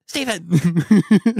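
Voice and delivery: loud, high scolding call of a name; mom yelling. Retold or imitated. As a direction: call the kid sharply across the store. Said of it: "she's like, she's like".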